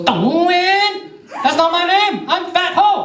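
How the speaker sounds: high-pitched outraged yelp; mock-furious woman correcting the teller; angry customer. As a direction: shriek it indignantly, way up high. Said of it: "she gets mad".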